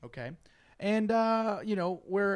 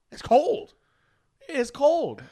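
exaggerated cold; 11 semitones higher, 10 dB louder, slower than usual. Cold on arrival.

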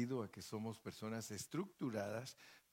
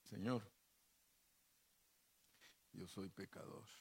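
hushed, softer pretend prayer to God; mock-humble prayer, much softer; cheeky prayer; 3 semitones lower, 7 dB quieter than usual. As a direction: murmur it like a sly confession. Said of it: "usted le podría decir a Dios".

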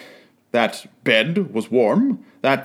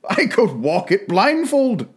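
fervent; 6 semitones higher than usual. A narrator's reading. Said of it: "cried Scrooge with fervor".

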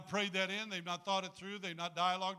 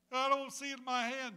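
mock whining complaint; 8 semitones higher, 6 dB louder than usual. Mock believer complaining to God.

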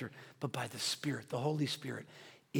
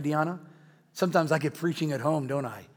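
ordinary delivery

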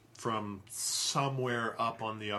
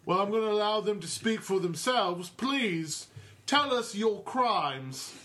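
stern constable; 8 semitones higher, 3 dB louder than usual. Acted. Constable questioning the prisoners.

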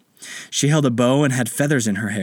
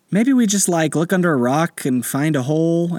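put-on child voice; 6 semitones higher than usual. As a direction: suggest it like a curious little boy. Anderson child voice.

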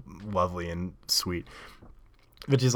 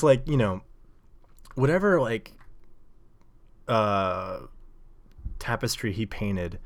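usual speaking voice

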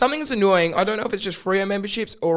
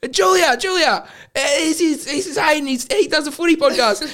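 put-on much higher, eager drunk voice; drunk introduction; 10 semitones higher, 4 dB louder than usual. Drunk brother introducing him to Julia, high and eager. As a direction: pitch it way up like a tipsy little brother.

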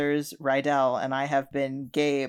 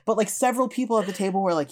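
normal talking voice

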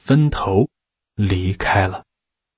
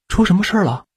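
higher, urgent worried ask; anxious question; 9 semitones higher, much faster than usual. A narrator's reading. Miss Jin anxiously asks the grieving husband, urgent worried. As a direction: press him for an answer, alarmed. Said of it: "金小姐着急地问".

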